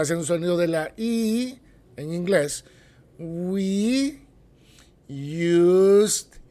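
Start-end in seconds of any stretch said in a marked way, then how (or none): none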